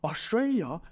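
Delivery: high, incredulous "Australia?"; surprised echo. Retold or imitated. Imitating a stranger abroad who learns he lived in Australia, surprised. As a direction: mimic a stranger's disbelief. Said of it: "Then they say".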